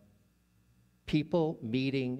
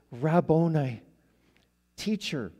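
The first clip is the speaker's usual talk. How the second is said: raised, louder cry of recognition; exclaimed recognition; 4 semitones higher, 6 dB louder than usual. Retold or imitated. Mary turns and recognizes the risen Jesus, exclaiming. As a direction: call out as if suddenly recognizing your teacher.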